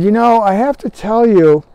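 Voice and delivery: a hint of elderly Southern sweetness; Peggy. Elderly customer confiding in the store caller, light Southern accent. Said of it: "elderly lady with a southern accent".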